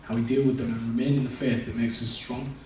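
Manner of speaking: normal talking voice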